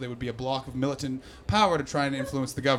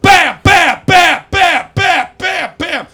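very loud, high bams; explosive shouted punch beats; punch sounds shouted; 13 semitones higher, 15 dB louder, much slower than usual. Acted. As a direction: hammer each bam like a punch. Acting out the knockout punches.

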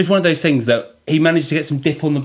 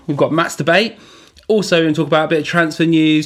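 ordinary talk, nothing marked

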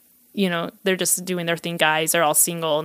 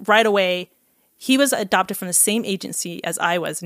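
usual speaking voice

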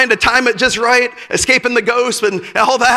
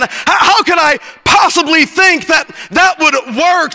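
normal talking voice

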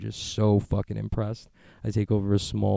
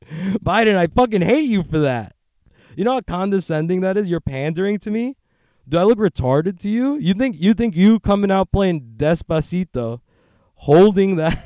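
mock outrage; 9 semitones higher, 8 dB louder than usual. Ranting at Biden.

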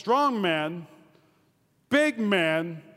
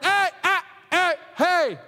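high, raised repeated heys; shouted interruption; 8 semitones higher, 4 dB louder, slower than usual. Acted. Preacher halting a bad report, raised interrupting calls. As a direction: keep cutting in loudly.

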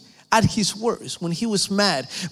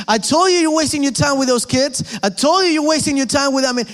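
much higher, louder, nagging scolding; scornful naysayer; 7 semitones higher, 4 dB louder than usual. Acted. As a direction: hammer every I told you with contempt. Preacher mimics discouraging friends.

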